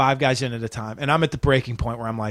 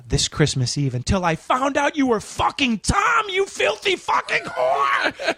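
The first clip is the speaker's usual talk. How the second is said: much higher, ending in a screamed insult; sweet turns to rage; building to a furious shout; 11 semitones higher, slower than usual. Retold or imitated. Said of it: "and I go".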